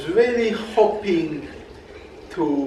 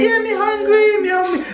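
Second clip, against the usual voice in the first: playful high whine; 4 dB louder than usual. Acted. Playful high hungry complaint.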